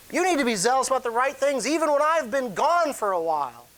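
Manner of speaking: high, insistent pleading as Paul; urgent exhortation. Acted. Preacher voices Paul urging church.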